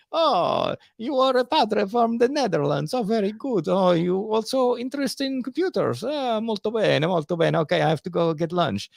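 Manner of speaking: high, jolly put-on voice of an old Italian prelate with Italian lilt; old Italian cardinal. Sister's story, the cheerful old cardinal who has no computer, high mock Italian voice. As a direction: ham up the old cardinal, breezy and pleased. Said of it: "this old guy, and he was like".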